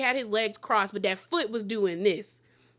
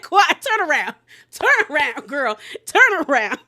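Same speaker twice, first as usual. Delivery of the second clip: calling out; 3 semitones higher, 6 dB louder than usual. Acted. Host yelling at Quad on TV, loud call. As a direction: shout it at the screen like she can hear.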